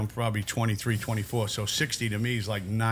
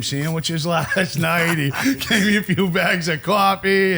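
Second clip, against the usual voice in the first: louder, higher, laughing through the words; 6 semitones higher, 5 dB louder, slower than usual. Real, not acted. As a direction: crack up while telling it. Cracks up retelling cellmate's boast.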